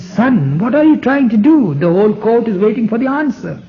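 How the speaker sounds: a king whose patience has run out; exasperated ruler pressing for an answer; exasperated emperor. Retold or imitated. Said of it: "He got exasperated and says".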